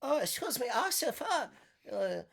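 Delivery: high-pitched mock Vietnamese accent; Vietnamese vendor accent. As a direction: play an eager food seller in a hammed-up Vietnamese accent. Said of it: "the Vietnamese booth, they're like".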